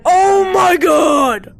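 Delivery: slow, loud shocked shout. Real, not acted. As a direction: drag out the shocked cry. Player dies in game.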